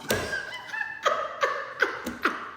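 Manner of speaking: a high, squealing burst of laughter; shrieking laugh. Real, not acted. Laughing high while telling her followers the story. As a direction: cackle high at your own joke.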